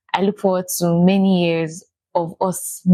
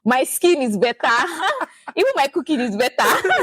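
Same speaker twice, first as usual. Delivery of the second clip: laughing through words; 8 semitones higher than usual. Real, not acted. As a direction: giggle as you say it. Laughing while listing.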